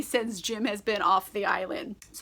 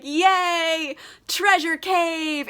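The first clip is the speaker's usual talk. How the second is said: drawn-out mock celebration, pitched up; happy cheer; 4 semitones higher, 3 dB louder, much slower than usual. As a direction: cheer slowly like delighted pirates. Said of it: "they're happy".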